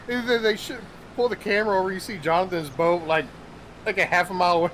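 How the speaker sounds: ordinary talk, nothing marked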